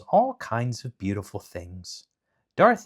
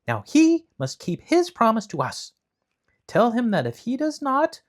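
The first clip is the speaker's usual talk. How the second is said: indignant; 11 semitones higher, 5 dB louder than usual. A narrator's reading. Scarecrow demands.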